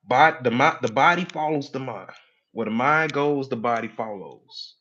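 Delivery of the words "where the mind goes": normal talking voice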